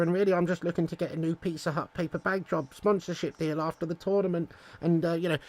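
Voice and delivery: quiet, sheepish mock manager; a hint of a meek, apologetic Southgate. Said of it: "Let's give him a call".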